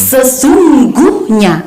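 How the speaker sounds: SESUNGGUHNYA drawn out very slowly, syllable by syllable; slow teaching demo of "sesungguhnya"; syllable-final consonant stress. Shown as a demonstration. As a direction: stretch se-SUNG-GUH-nya, leaning on the closing consonants. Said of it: "Huruf konsonan yang terletak di akhir suku kata".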